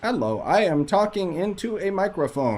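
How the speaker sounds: put-on high, flat, robotic kid voice; emotionless child voice. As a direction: read it like a bored child actor. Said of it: "she has no emotion whatsoever".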